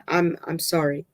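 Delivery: plain reading voice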